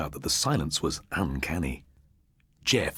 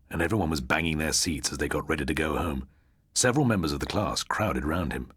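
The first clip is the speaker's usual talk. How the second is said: ordinary delivery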